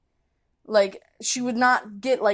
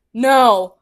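loud flat refusal; blunt no; 11 dB louder than usual. Retold or imitated. To the stranger, a loud blunt no. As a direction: shut her down with a loud no. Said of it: "I'm like".

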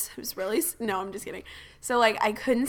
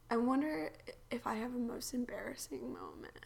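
soft whisper to herself; 10 dB quieter than usual. Real, not acted. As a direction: whisper the thought as if musing aloud. Musing to herself mid-story.

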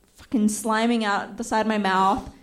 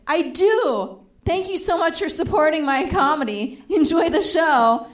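high, bright mock-cheerful thanks; chirpy fake-friendly reply; overly cheery; 6 semitones higher, 3 dB louder than usual. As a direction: breezily thank her like a fan.

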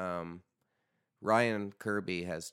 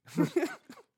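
a high burst of laughter; real laugh after a silly accent; 5 dB louder than usual. Real, not acted. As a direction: laugh high at your own bit. Laughs at own accent bit.

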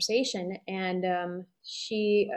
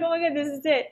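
excited; 6 semitones higher, faster than usual. Retold or imitated. Mock excitement after laugh.

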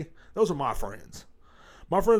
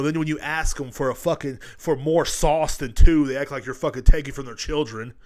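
ordinary talk

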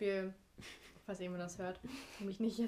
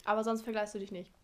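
talking around a mouthful of blueberries; chewing while talking. Real, not acted. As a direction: eat blueberries while asking. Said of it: "Sorry, ich esse grad Blaubeeren".

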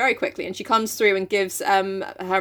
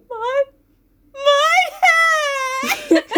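high, louder hammed-up wail; mock sob; 5 dB louder, much slower than usual. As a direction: cry out like a heartbroken girl. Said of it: "she's like".

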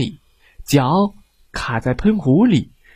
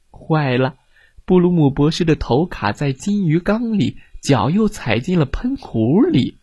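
ordinary delivery, nothing marked

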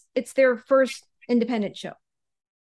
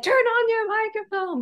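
higher mock yell of "Turn on your microphone"; 11 semitones higher, 3 dB louder than usual. Heckler yelling at the new ring announcer, mock yell. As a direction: shout it up at the ring, lightly. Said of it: "this guy who basically said".

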